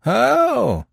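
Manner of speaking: high, loud challenge; scoffing high cry; defiant. A narrator's reading. Prisoner answers the detective's pressure, defiant. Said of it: "cried the prisoner in defiance".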